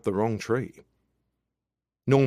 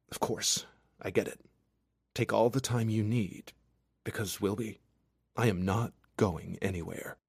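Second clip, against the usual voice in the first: soft, tender reassurance; reassuring lover; 5 dB quieter than usual. A narrator's reading.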